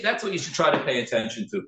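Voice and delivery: ordinary talk, nothing marked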